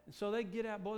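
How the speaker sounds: ordinary talk, nothing marked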